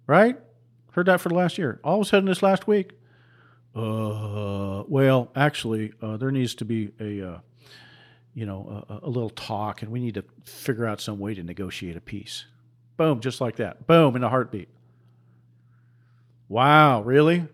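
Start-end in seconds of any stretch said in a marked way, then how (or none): 3.7-12.4 lower, softer, hesitant mock official voice. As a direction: mimic a sheepish official fumbling for words. Mimics officials' sudden change.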